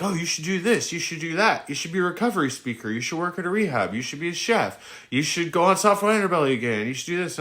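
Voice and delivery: high, rattling list of suggestions; mimicking pushy advice-givers. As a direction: parody everyone telling him what to do. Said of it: "And I'm like".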